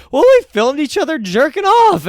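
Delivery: high, loud sheepish admission; mock confession. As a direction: blurt it out like a guilty kid.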